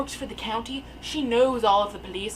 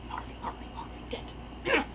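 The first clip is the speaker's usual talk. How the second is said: hushed, voiceless repetition of the name; playful whisper; 5 semitones higher, 11 dB quieter than usual. Pretend. Playful aside on Batman character name.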